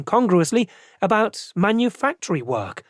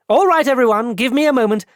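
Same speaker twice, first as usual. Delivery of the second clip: louder, higher call to everyone; calling to the company; 8 semitones higher, 5 dB louder than usual. Acted. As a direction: hold the room's attention. Director addressing whole company.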